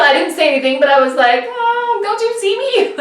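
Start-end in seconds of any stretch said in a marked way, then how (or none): 1.5-2.9 very high, plaintive mock wail; retold dismayed protest. Racer's unspoken plea to a close bicyclist, dismayed. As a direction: whine it up high without shouting.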